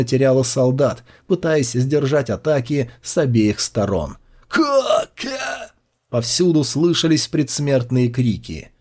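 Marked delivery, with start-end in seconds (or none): 4.5-5.7 high, strangled death cry; dying gasp. A narrator's reading. Dying screams.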